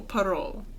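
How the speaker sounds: parole as puh-ROLL with a soft, short o. Shown as a demonstration. Co-host explaining the Christmas star word, parole with a soft o. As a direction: say parole gently with a soft o, unlike parole officer. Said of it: "it's like a soft O".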